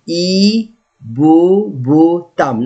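"Hippopotame" slow and syllable by syllable, no H; silent H. Shown as a demonstration. Teacher shows the unspoken letter H, silent H. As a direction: start "Hippopotame" straight on a long ee, silent H. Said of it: "H, I will not see it".

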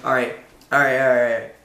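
usual speaking voice

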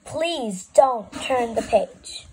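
slow, deliberate, each word spaced; very clearly. Shown as a demonstration. Narrator to the reader, slow and very clear. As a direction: spell it out slowly so they can't miss it. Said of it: "I'll say it once more very clearly".

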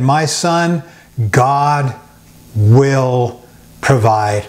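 each word set apart with pauses; My son, God... will... provide, with a beat before each word; slow, spaced out. Shown as a demonstration. Pastor quoting Abraham to Isaac, slow and deliberate. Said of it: "I love to say it just like that".

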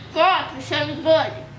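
cheeks stuffed full. Real, not acted. Four marshmallows stuffed in.

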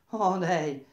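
a sudden loud, high "Oh, dei"; awed exclamation. A narrator's reading. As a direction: cry out in wonder. Watching brother run for life.

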